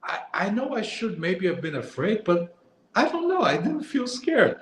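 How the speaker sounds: high, light voice for the woman; breezy mock-girlish voice; quoting her friend. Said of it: "she was like".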